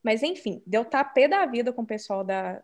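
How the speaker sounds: ordinary talk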